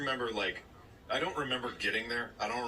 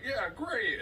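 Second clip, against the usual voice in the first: mildly enthusiastic higher-pitched dad; pleased dad's approval; 10 semitones higher than usual. Retold or imitated. Dad approving of the singing, mildly enthusiastic. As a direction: imitate an approving parent, pitched up.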